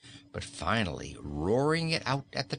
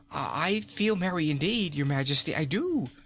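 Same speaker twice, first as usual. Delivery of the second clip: anxious protest; 7 semitones higher than usual. A narrator's reading. Frightened king protests to queen, high forced cheer. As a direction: insist you're merry. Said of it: "protested Rinkitink".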